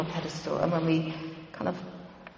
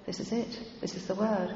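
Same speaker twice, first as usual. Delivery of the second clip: much softer, a touch of weeping; quiet tearful reply; 8 dB quieter than usual. Retold or imitated. The crying woman giving John her word, soft and tearful. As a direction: barely voice it through mild tears.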